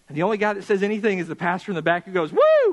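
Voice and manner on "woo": high, loud wild whoop; crazy man whoop. Retold or imitated. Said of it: "like a crazy man".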